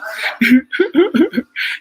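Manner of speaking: high burst of laughter; loud high laugh. Real, not acted. Vlogger laughing hard at Nicole's reassuring comment. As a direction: let out a big high laugh.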